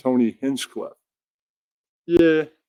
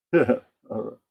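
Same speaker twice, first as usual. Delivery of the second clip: ordinary delivery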